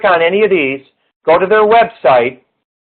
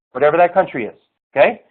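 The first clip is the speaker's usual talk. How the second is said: normal talking voice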